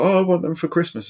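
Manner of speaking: high-pitched imitation of a child; young daughter. Dad retelling daughter's Christmas wish, high mimicked child voice. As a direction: lightly mimic your little girl asking. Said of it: "So she's like".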